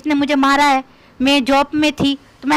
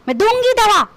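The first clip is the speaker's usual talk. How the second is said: usual speaking voice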